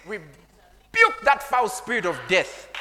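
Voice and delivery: slow, drawn-out exorcist's rebuke; acted rebuke. Preacher re-enacting casting out a spirit, forceful rebuke. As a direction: rebuke it with slow, heavy emphasis.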